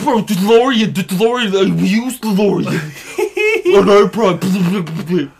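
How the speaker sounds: loud sputtering Porky Pig voice; Neri impression. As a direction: stammer and spit like Porky Pig. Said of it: "like a Cuban Porky Pig".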